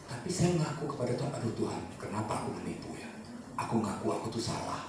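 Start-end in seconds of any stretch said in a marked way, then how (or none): 1.3-4.8 quiet confession. Retold or imitated. Confessing prayer to God quoted.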